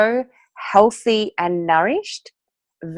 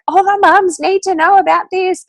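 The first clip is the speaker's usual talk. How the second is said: eager announcement; 13 semitones higher, 7 dB louder than usual. Retold or imitated. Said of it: "I'm like".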